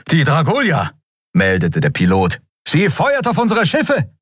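high-pitched alarmed pilot; urgent, high-voiced combat alert; urgent battle report.

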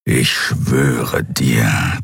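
very low, slow beastly growl. A narrator's reading. Huge beast warrior growls a threat at the addict, low slow growl. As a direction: growl the threat from deep in the chest. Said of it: "knurrte der Skria".

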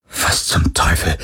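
a slow, unvoiced horrified breath. A narrator's reading. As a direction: barely breathe the words in shock. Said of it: "hauchte Parker". (entsetzt gehaucht)

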